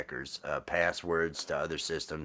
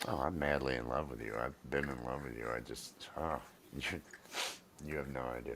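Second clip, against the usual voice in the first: soft, low love confession; hushed; 7 semitones lower, 11 dB quieter than usual. Real, not acted. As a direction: say it softly and tenderly.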